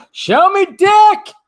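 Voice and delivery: high, loud, stretched-out shout; mock shout. Vlogger shouting his catchphrase, high and loud. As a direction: bellow it slowly like a battle cry. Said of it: "catchphrase shouted".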